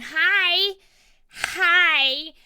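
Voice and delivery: shouted, excited hellos; loud hi. Real, not acted. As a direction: greet the viewers with a loud burst. Greeting viewers after long absence.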